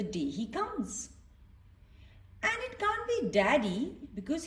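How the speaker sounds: gentle read-aloud voice for little ones; storybook reading. A narrator's reading. Reading a picture book to children, gently storytelling.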